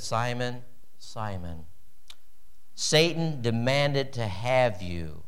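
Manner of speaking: hushed, slow and low, weighing every word; sobering hush. Shown as a demonstration. Pastor hushes Jesus' warning to Simon, sobering and slow. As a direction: let the warning sink in quietly. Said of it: "he is uttering some words that are sobering".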